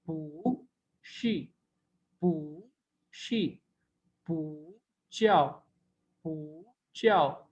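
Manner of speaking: pू spoken slowly with tone changes; drawn-out पू showing the tone sandhi; bù tone demo. Shown as a demonstration. Teacher models पू tones slowly for the class. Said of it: "ये जो है वो पू का rule था".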